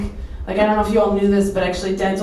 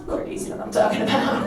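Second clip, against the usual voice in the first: flirty whisper; 5 dB quieter, faster than usual. Real, not acted. Flirty aside, unvoiced.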